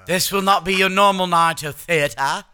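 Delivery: loud high grand theatre intro; loud high mock theatrical announcer; pompous announcer. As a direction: announce like a stage herald.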